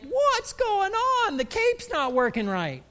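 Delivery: high, loud mock wail; distressed student. Student whose superpowers fail, comic panicked wail. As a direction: whine it out, slow and stretched, then falling. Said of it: "and you're like".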